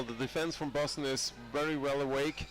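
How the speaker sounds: ordinary delivery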